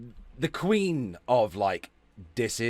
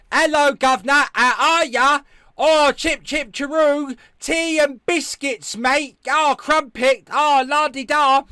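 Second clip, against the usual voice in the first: high, loud, hammed-up mock British accent; American badly faking a British accent; 11 semitones higher, 9 dB louder, slower than usual. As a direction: parody British, drawn-out and shouted. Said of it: "every American trying to do a British accent".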